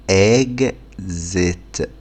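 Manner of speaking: إكزيت dragged out, eg-zet with a falling end; very slow exit. Shown as a demonstration. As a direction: stretch إكزيت as far as it goes. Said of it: "تالت". (إكزيت ممدودة جدًا)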